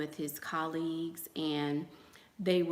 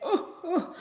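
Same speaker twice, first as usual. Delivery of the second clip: mock ooh; 12 semitones higher, 5 dB louder than usual. Mock cheering.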